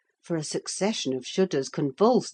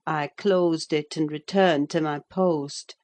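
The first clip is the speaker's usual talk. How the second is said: usual speaking voice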